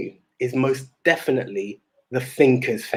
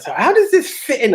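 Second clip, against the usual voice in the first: mock girlfriend; 11 semitones higher than usual. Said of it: "she was like".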